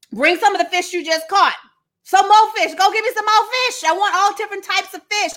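high, playful demanding voice; demanding Jesus. Acted.

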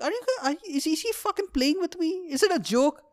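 high-pitched mock disbelief; incredulous student. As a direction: retell baffled thoughts in a high voice. Baffled student's thoughts about professor.

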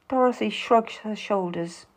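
plain reading voice

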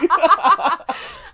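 loud bright laugh; real laughter. Real, not acted. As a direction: laugh hard. Laughing at own joke.

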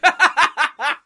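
high, loud real laugh; big high peal of laughter. Real, not acted. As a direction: burst out laughing at your own joke. Cracks up at own joke.

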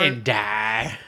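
lightly voiced sinister djinn; hint of a cruel genie. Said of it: "the Jin's like".

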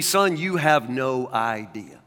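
soft and slow, like a father reassuring his son; gently, slowly, with quiet kindness; kind, wise God voice. Retold or imitated. The Lord kindly answering the speaker's angry question, soft and slow. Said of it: "the Lord so very kindly and wisely say".

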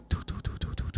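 soft, voiceless rhythmic "do-do-do"; hushed staccato effect noise; imitated strobing stutter. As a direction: tick out tiny repeated syllables. Imitating stuttering vision while high.

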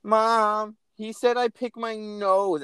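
high, slow mocking whine of a tattling child; whiny kid. Mocking a whiny tattling child, high and slow. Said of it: "these kids being like".

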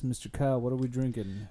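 ordinary talk, nothing marked

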